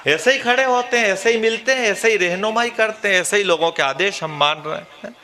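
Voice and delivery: ordinary delivery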